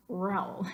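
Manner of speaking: "Raúl" as a single syllable, like "rowl"; one-syllable RAÚL. Shown as a demonstration. Teacher repeats the terrible version, "Raúl" in one syllable. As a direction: glide "Raúl" into one beat, stress on the a. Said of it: "That just sounds terrible".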